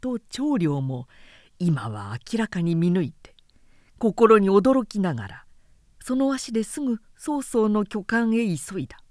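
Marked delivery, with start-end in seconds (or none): none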